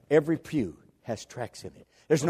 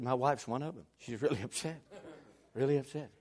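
softly voiced tale-bearer; confiding gossip; 8 dB quieter than usual. Church member telling preacher about his wife, hushed confiding. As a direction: pass it on quietly, as a confidence.

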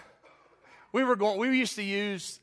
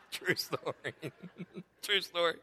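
laughing; 9 dB quieter than usual. Real, not acted. Wheezing with laughter after punchline.

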